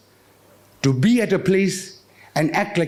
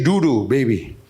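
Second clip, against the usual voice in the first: lullaby voice; 8 semitones lower than usual. Retold or imitated. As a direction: sing it like putting a baby to sleep. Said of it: "we say".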